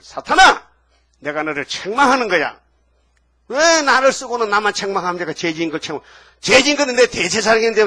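사탄을 꾸짖는 큰 호통; 높고 크게 내지르는 꾸짖음; angry rebuke. Acted. (사탄을 향한 하나님의 격한 호통)

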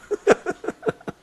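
short, high burst of laughter; a squeaky amused laugh. Real, not acted. Laughs at mention of politician.

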